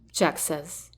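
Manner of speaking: ordinary delivery, nothing marked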